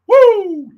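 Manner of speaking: high, loud whoop of excitement; excited whoop. Real, not acted. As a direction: let out a big woo. Hype yell after intro.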